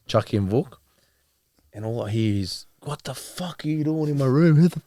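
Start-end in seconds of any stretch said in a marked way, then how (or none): 2.8-4.8 raised, angry retold outburst; brother going off. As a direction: imitate an angry brother, pitch and volume up. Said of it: "my brother's going off".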